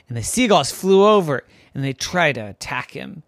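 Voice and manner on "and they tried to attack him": usual speaking voice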